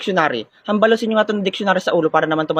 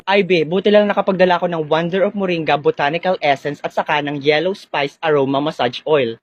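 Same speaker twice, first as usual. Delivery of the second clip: lower, measured product-plug voice; ad read; 4 semitones lower than usual. Acted. Friend plugs massage oil after the war game, low commercial read.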